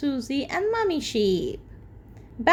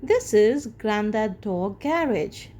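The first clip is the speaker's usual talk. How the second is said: usual speaking voice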